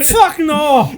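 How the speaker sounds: high, loud comic refusal; hammed-up outraged cry from a stand-up routine; Robin Williams. Friend echoing the golf sketch, mock outraged shout. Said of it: "that Robin Williams sketch".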